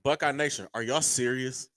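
ordinary talk

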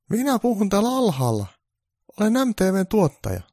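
high, polite producer voice; producer's high phone voice. Acted. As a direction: introduce yourself politely.

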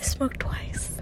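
breathy whispered mock repeat; whispering. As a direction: whisper it back teasingly.

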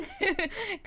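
short bright laugh at herself; burst of laughter. Real, not acted. Coach laughing at her own high voice.

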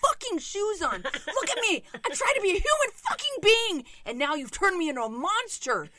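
high, loud hammed-up rage with a laugh; furious yell. Mom venting at her son in a mock furious yell. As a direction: scream it like you've lost it. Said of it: "'cause I wanna say".

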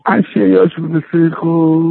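low, slow prayer drone; slow droning prayer. Retold or imitated. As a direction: imitate a rabbi lost in slow prayer. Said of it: "כל התפילה הוא ככה".

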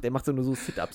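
ordinary talk

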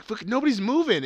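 high urgent mock panic; tense alarm. Recalling his own reaction to the knife scene, high alarmed blurt. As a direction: blurt it like you're watching it happen.

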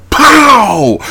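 high, loud "pow" impact; big mimicked blast; explosive punch noise. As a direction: shout out a comic-book punch. Imitates a superhero punch.

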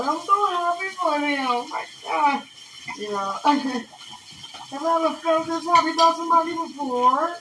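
high-pitched, tearful happy wail; about to cry. Acted. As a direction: gush as if overcome with happy tears. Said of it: "I'm about to cry just now".

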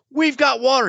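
overjoyed man in the desert; excited cry. Acted. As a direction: announce it to everyone gleefully. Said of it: "And there he goes".

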